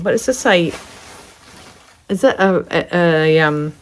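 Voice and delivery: normal talking voice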